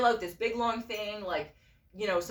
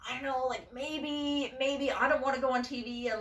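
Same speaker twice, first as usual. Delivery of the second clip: higher, hesitant retold reply; reluctant wavering; 5 semitones higher than usual. Answering the pushy friend, reluctant and wavering. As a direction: hedge and wriggle out of it.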